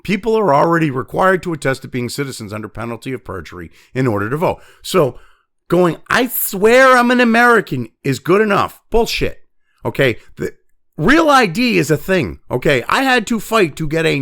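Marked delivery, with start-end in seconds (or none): 6.1-7.9 higher, louder mock oath; mocking quote. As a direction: put on a mocking, high-pitched pledge. Mocking imagined voter's oath.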